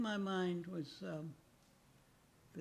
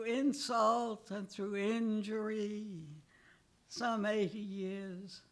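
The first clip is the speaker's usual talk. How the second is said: rhythmic recital, louder on the defiant couplets and slowing toward the end; poem reading; 11 dB louder, slower than usual. Acted. Aging poet reads his poem Catalog to the audience, rhythmic and wry. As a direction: give each couplet its beat, then ease into mourning.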